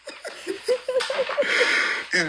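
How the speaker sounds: high wheezing laugh breaking out; burst of laughter. Real, not acted. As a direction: laugh hard at the chat. Laughing at chat comment.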